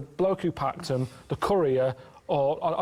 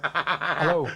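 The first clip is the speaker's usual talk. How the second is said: lightly voiced kidnapper hello; playing kidnapper. [name] playing the kidnapper on the phone, lightly voiced. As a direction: answer as the captor, gruff intent. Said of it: "play him".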